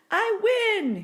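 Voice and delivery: loud, high victory cry; triumphant shout. A narrator's reading. Mister Brisbane shouting his win at the hamster. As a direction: crow the win at the top of your voice. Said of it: "he shouted triumphantly".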